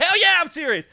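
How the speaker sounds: loud high retort; angry retort. Retold or imitated. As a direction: snap back heatedly. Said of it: "He's like".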